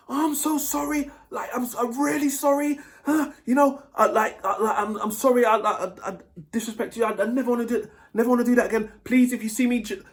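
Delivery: put-on high-pitched pleading girlfriend voice; mock-beg for forgiveness in a high voice; hammed-up sorry girlfriend, pleading; begging girlfriend. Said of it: "Begging, begging, begging".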